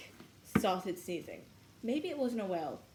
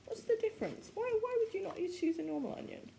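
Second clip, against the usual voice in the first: mock outrage; 12 semitones higher than usual. Real, not acted. Mock outrage at green onions.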